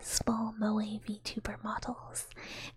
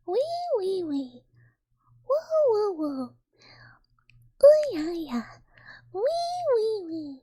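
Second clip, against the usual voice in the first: very high, loud squeals falling away; wee-woo squeal; 10 semitones higher, 10 dB louder than usual. Shown as a demonstration. Streamer performing the wee wee wee redeem for chat, squealing. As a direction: squeal playful wees, woos and yeahs.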